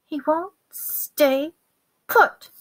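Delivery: high, slow, strained complaint; struggling exasperation. A narrator's reading. Ron holding on to his terrified rat, exasperated. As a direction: force it out while wrestling something wriggling.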